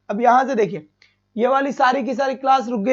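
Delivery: ordinary talk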